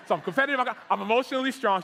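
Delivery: declaring his strengths loudly to the manager; confident loud declaration. Retold or imitated. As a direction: announce it like you mean it. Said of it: "Yeah, that's better".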